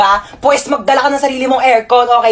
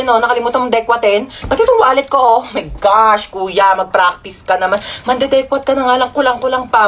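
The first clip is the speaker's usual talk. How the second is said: normal talking voice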